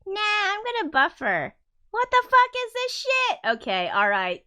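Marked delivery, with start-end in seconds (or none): 0.0-1.5 high, lazy mock computer voice; voicing the computer. The buffering computer talking back, mock lazy. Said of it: "now it's like". 1.9-3.4 high, loud fed-up cry; exasperated outburst. Real, not acted. Annoyed at the buffering video, exasperated.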